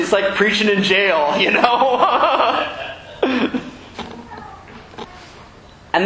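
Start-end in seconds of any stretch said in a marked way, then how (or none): 0.0-3.6 laughing. Real, not acted. Laughs at own joke.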